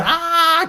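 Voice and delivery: high, sharp yelp of pain; cry of pain. Retold or imitated. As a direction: let out a short pained cry. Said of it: "qui crie de douleur". (cri de douleur aigu)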